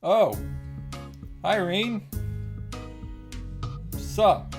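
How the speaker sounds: normal talking voice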